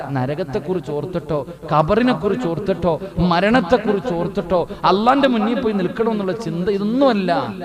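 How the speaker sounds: loud, rising rhetorical list; impassioned exhortation; forceful preaching. Real, not acted.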